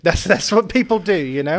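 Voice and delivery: laughing it off, pitch up; rueful laugh. Real, not acted. Father laughing ruefully to his son about people leaving. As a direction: laugh through the hurt of being left. Laughs through painful admission.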